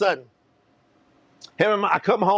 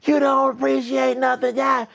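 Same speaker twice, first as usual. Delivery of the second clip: retold screaming; 6 semitones higher, slower than usual. Stepmother raging at her stepson, mock screaming. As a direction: imitate a screaming stepmother, pitched up. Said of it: "my stepmother starts screaming".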